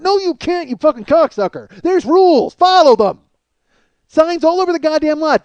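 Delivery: angry rant. Acted. Ranting at parker.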